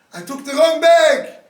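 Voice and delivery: high, loud, stricken realization; horrified cry. Retold or imitated. Mother realizing on the train she took the wrong bag, horrified cry. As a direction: cry it out as the horror lands. Mother discovers baby left behind.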